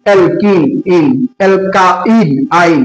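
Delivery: slow, deliberate mnemonic chant; slow recitation. Shown as a demonstration. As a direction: drill the rule slowly for students. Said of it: "আইন বলার সময় আসে". (ধীরে ধীরে মুখস্থ বলানো)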